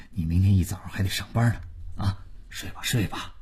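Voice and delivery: very soft, hurried placating mumble; mild peacemaker. Acted. Elder calming family quarrel at night, placating. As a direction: soothe everyone quietly and send them to bed.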